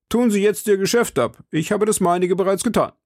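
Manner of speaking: eager servant. Acted. Planchet voiced higher.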